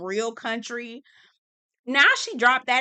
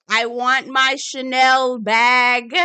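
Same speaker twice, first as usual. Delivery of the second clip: loud, drawn-out mock demand; playful shout; 3 semitones higher, 12 dB louder, much slower than usual. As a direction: holler a wish out to the universe. Shouting her wish into the atmosphere.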